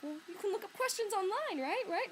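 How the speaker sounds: high-pitched persuasive retort; retold coaxing. Patron suggesting online questions to librarian, high eager coaxing. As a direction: push for agreement, ending on little questions. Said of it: "And I was like".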